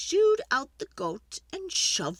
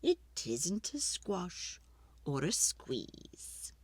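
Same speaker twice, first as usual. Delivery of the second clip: fading ending; 3 semitones lower, 9 dB quieter, slower than usual. A narrator's reading. Story ending fades.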